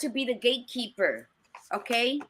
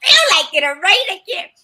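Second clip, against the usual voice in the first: high, loud, fast retort; teen shouting back, much higher and louder; daughter yelling back; 10 semitones higher, 9 dB louder, faster than usual. Retold or imitated. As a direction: fling it back at mom, fed up. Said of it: "she's like".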